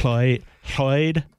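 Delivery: LLOYD starting with air blown past the tongue; Welsh double L. Shown as a demonstration. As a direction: tentatively hiss the LL of LLOYD from the sides. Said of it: "Yes, try it".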